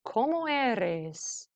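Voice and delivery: "¿Cómo eres?" said very slowly for students to repeat; drawn-out model phrase, each word spaced; slow teaching demo. Shown as a demonstration. Teacher modelling the question for students, very slow. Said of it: "you ask".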